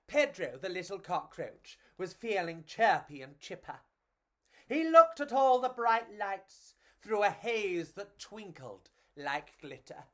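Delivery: usual speaking voice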